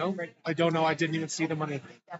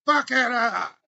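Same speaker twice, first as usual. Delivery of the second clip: shouted crude heckle; loud heckle; 6 semitones higher, 8 dB louder than usual. Real, not acted. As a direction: yell it at the caller. Heckling the caller.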